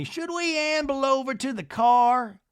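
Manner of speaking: high, slow put-on grande dame; Miss Patricia. Miss Patricia to Whitney, high drawn-out suggestion. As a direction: imitate her in a lofty, stretched high voice. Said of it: "Miss Patricia tells Whitney".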